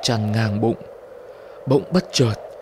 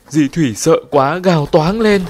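ordinary delivery

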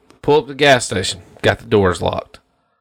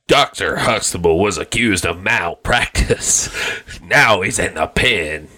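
movie trailer voice; 7 semitones lower than usual. Pretend. Mock trailer for a reboot.

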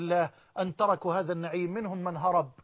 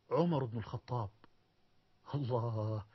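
much lower and softer, a whispered answer; hushed reveal; 8 semitones lower, 8 dB quieter than usual. Acted. Preacher to congregation names Omar after a long pause, hushed and low. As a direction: drop to a reverent hush.

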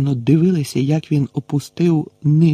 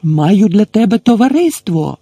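loud, much higher bellow; big shout; 8 semitones higher, 6 dB louder than usual. A narrator's reading. Gamekeeper bellowing up to the giant, loud and high. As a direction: hail him from far below. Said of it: "знову крикнув Гегрід".

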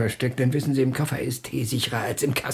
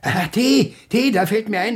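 sudden realisation; 9 semitones higher, 5 dB louder than usual. Acted. Suddenly remembers the tea.

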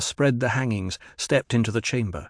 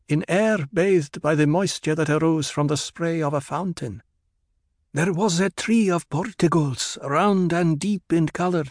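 higher, lilting character voice, slowing at the close; Hera's ritual recital; 7 semitones higher than usual. A narrator's reading. Hera recalling peace on Bela Tegus, high ritual recital. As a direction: recite the memory in a raised voice.

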